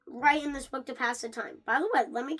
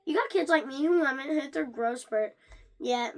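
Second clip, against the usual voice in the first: ordinary delivery, nothing marked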